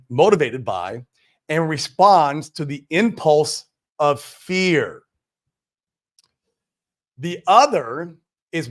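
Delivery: slow, weighty reading with long pauses; slow read-aloud. Real, not acted. Host reading a lesson on fear and faith, slow and deliberate. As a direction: read it slowly, letting each key phrase land. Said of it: "we're gonna read it slow".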